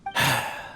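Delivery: long, low tired sigh; weary sigh. Acted. Inventor sighs at his unresponsive robot, weary. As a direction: let the breath out in resignation. Alone, robot can't understand him.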